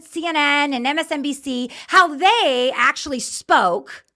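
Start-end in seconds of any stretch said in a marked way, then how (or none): none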